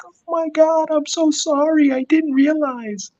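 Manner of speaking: very high, slow gushing apology; hammed-up sorry Karen; mock apology. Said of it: "she was like".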